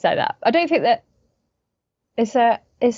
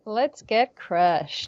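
softer, slow, drawn-out party line; half-hearted slow echo of a party cry; subdued echo; 5 dB quieter, much slower than usual. Acted. Actress repeating the interviewer's line, subdued and slow.

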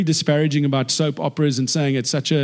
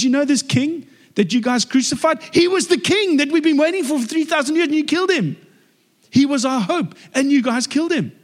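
Peter's accusation; 11 semitones higher than usual. Retold or imitated. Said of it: "He says".

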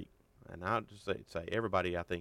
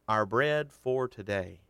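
slow, louder our bread for today; stress on bread; 2 semitones higher, 5 dB louder, slower than usual. Shown as a demonstration. Preacher naming where Jesus put the emphasis, slow stress. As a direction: weigh each word. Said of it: "He puts the emphasis on".

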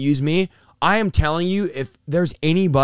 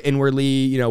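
ordinary talk

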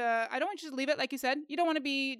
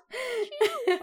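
high squeaky "chew" through laughter; playful high kitty "chew"; cat-like goodbye; 11 semitones higher, 4 dB louder than usual. Shown as a demonstration. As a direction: mew the sign-off like a cat, giggling. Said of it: "it's like a cat saying goodbye".

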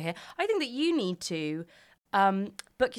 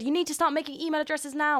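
lightly voiced pushy mum; a hint of a bossy mother; 7 semitones higher than usual. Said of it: "she said".

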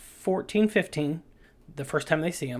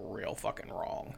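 hushed; 8 semitones lower, 12 dB quieter than usual. Pretend. Dramatic hushed ending to theme pitch.

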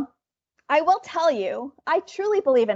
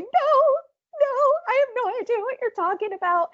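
much higher-pitched pleading denial; retold crying plea; 12 semitones higher than usual. Employee crying to the yelling manager, mock tearful plea. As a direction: plead your innocence, on the edge of tears. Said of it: "I'm, like, crying".

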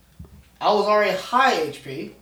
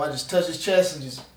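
ordinary delivery

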